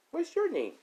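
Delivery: put-on high-pitched little kid; child's voice. Child meeting another child, high kid voice. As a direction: ask like a small child.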